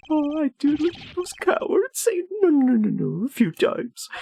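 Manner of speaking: very high, sing-song mock pleading; mocking villain. Villain mocks the cowards' pleas, very high. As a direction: squeak out the no's in a taunt.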